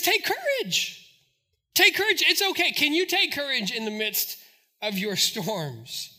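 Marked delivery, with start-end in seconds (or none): 0.0-2.7 high, urgent encouragement; rally the frightened with raised voice; Paul. Retold or imitated. Paul urges shipmates to be brave, high and insistent. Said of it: "he says, he says".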